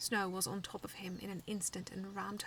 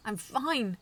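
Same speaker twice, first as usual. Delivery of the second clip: growled louder; 3 semitones higher, 10 dB louder than usual. A narrator's reading. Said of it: "I growled".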